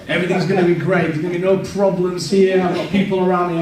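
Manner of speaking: loud, high mock confidence; exaggerated optimism. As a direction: oversell the rosy outlook, hammed-up. Naive confidence built up for laughs.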